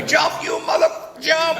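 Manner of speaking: high-pitched mock shout; mock crowd yell. Campers yelling up at a man on the bridge, mock yelling. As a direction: imitate hecklers yelling up from below. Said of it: "they're yelling at me".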